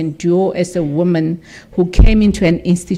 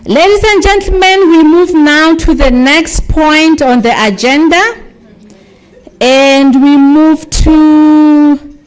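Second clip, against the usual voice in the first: higher, louder MC announcement; announcing to the hall; 7 semitones higher, 6 dB louder than usual. Real, not acted. MC calling the audience to the keynote, loud and high. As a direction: project it out to the whole room, higher up. MC announces the next agenda point.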